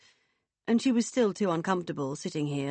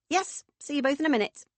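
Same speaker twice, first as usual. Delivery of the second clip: high, quick, breezy farewell; booming hearty woman; 9 semitones higher, faster than usual. Acted. Woman leaving the pair in the garden, breezy. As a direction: toss off a brisk goodbye. Vicky's character voice.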